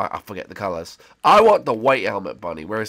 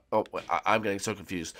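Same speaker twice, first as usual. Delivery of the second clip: ordinary talk, nothing marked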